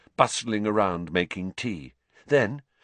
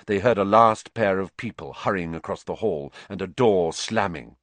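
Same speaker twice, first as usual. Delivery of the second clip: ordinary delivery, nothing marked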